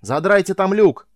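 high shout through a helmet radio; pilot yelling over the radio. A narrator's reading. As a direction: yell it over the comms. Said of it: "заорал голос пилота по шлему".

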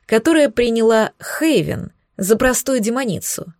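plain reading voice

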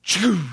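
loud burst of "choo" like a lock; imitated lock noise. Preacher imitating prison doors for the congregation, imitated lock noise. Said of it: "you'll hear those".

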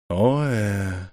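a lazy, musing drawl; drawn out. Acted. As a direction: stretch the sounds as if thinking. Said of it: "протянул государь".

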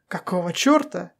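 usual speaking voice

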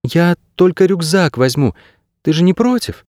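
put-on high, sweet-talking girl voice; coaxing, affectionate. A narrator's reading. Girl reaching for her rucksack beside a dog, coaxing. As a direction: coax the dog gently in a high pitch. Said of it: "приговаривая ласковым голосом".

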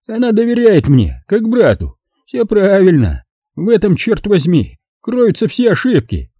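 a hint of a bitter drunk; Brogan's voice.

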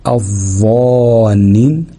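الظانين stretched very slowly with a doubled ظ; ترتيل الظانين ببطء; slow recitation. Shown as a demonstration. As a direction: intone الظانين slowly, no lam heard. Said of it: "كلها مفيش لام".

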